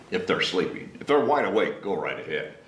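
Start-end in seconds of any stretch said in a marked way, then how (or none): none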